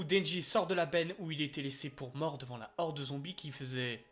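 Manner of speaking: ordinary talk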